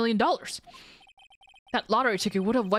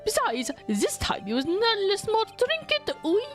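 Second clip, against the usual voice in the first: very high, coy French chef voice; French accent; 12 semitones higher, slower than usual. Acted. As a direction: play a timid girl in French accent. French chef, timid then defensive.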